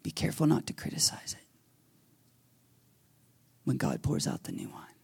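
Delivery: quick, soft whisper, letting it sink in; hushed whisper. Acted. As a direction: breathe the warning quietly. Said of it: "preacher repeats message, hushed".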